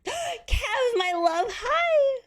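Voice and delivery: light up as a dear friend shows up; excited greeting. Real, not acted. Greets a friend arriving in chat.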